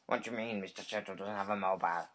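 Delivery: ordinary delivery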